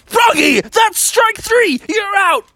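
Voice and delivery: high-pitched shouted reprimand; scolding teacher. Acted. Teacher kicking Froggy out of the meeting, shrieked scolding. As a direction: shout it like an umpire's final call.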